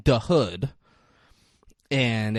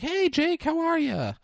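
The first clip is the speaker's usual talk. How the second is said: chirpy family greeting. Retold or imitated. Family greeting at dinner, retold.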